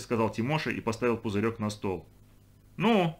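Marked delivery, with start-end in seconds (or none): 2.8-3.2 high, sharp-risen exclamation; cook's indignant cry. A narrator's reading. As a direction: cry out in protest. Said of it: "закричала кухарка". (возмущённый крик кухарки)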